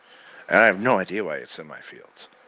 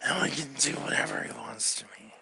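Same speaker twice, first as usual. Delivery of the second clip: soft whisper throughout; 6 dB quieter than usual. Retold or imitated. The father voiced in the story, whispering. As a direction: keep it to a whisper all the way.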